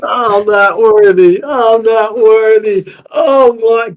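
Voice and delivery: high, loud, slow chant of unworthiness; bow down and wail your devotion; Wayne's World worship. Acted. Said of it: "Fucking Wayne's World".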